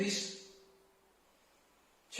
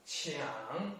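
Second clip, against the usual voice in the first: "qiang" dipping very low, then rising; soft low scoop on "qiang"; third tone; 6 semitones lower, 6 dB quieter than usual. Shown as a demonstration. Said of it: "third tone".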